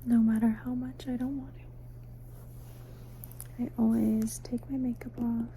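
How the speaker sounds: usual speaking voice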